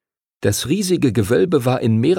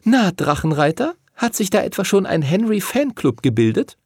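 high-pitched teasing question; teasing schoolgirl; 10 semitones higher than usual. A narrator's reading. Lucy teases Henry about his fan club, teasing. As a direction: needle him in a high voice.

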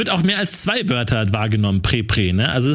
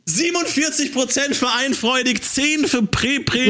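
high, lively result announcement; game show host; 8 semitones higher than usual. Acted. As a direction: present the results like a quiz host. Moderator verkündet Abstimmungsergebnis.

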